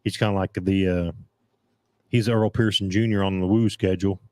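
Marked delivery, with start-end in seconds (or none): none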